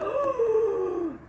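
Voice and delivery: high sharp shocked gasp. Retold or imitated. Seeing blistered hands at work, sharp gasp. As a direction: suck in air as if spotting the blisters. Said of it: "and I'm just like".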